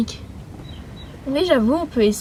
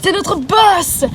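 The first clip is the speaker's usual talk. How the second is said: dramatic announcement; 6 semitones higher, much slower than usual. Acted. Villain announces the boss.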